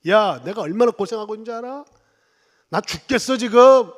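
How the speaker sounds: imitating a loud complaining dad; mock snapping at the family; nagging outburst. Father nagging his family, mock loud complaint. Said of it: "잔소리하기 시작".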